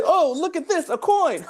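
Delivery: put-on very high delighted kid voice; high excited discovery; childish wonder. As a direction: gasp at a shiny coin like a little kid. Daughter distracted voiced.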